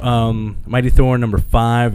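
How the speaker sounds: ordinary delivery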